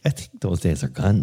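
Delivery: ordinary talk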